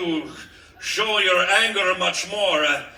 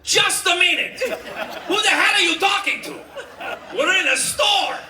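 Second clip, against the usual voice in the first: shouting high in outrage; 10 semitones higher than usual. Acted. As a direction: bark it at the customer. Store owner shouting at customer.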